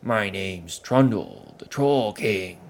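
slow, loud troll king announcing himself; Trundle the troll, commanding. Acted. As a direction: boom out his name and title.

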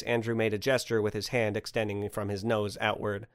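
usual speaking voice

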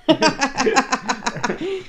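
high, drawn-out laughing protest. Real, not acted. Begging off doing an accent on request, laughing. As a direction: laugh through the words.

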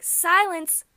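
high, loud mimicked lyric; imitating the singer's delivery. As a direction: belt the word out like the singer. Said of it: "the way she says".

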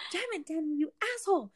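high-pitched mock cursing; retold exasperation. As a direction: curse him in a high, fed-up voice. Acted exasperation at Danny.

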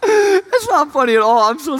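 real laughter breaking through a high voice; can't stop laughing. Real, not acted. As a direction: apologize while helplessly laughing. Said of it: "I don't know why I'm laughing".

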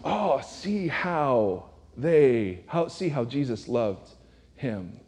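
slow, soft, sighing pity; drawn-out sentimental sigh of the onlookers; mock sentimental crowd. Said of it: "And everyone went".

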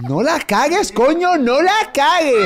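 high, slow, laughing imitation of her yell; mock self-scolding. Host mimicking the actress's panic, high and laughing. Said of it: "imitating her, laughing".

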